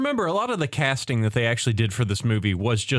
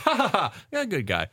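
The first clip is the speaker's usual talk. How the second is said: evil laugh; 6 semitones higher than usual. Retold or imitated. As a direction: ham up a cartoon villain's laugh. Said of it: "he's doing the evil laugh".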